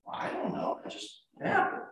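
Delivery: very soft, almost voiceless; sheepish reply. Acted. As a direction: answer under the breath, as if at a loss.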